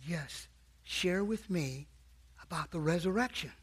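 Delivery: faint dying voice. Retold or imitated. Dying mother asking coach about the resurrection, faintly. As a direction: barely breathe the request. Dying woman asks softly.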